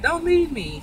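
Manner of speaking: high-pitched mock whine, lightly done; retold crying plea, half-hearted and high; mock tearful plea. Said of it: "he all crying and he all sad".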